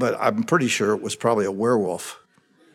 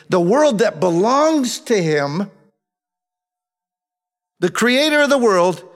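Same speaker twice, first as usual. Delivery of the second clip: high, slow, emphatic preaching; 7 semitones higher, 3 dB louder than usual. Real, not acted. Preacher proclaiming God become flesh to the congregation, fervently. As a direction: proclaim it with drawn-out key words.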